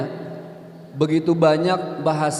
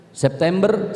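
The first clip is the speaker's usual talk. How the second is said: Medan-style "September" with open E vowels, rising; mock Medan E in "September"; Medan accent; 4 semitones higher, slower than usual. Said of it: "Orang Medan itu identik dengan E".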